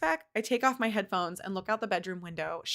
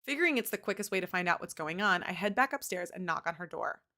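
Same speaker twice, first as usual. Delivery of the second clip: usual speaking voice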